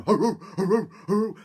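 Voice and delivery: high, loud baying bark; bay as you chase; dog barking. Acted. Dog chasing the gingerbread man, loud high baying.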